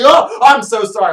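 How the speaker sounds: high, loud startled gasp of an apology; mock startled woman. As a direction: jump back and blurt it.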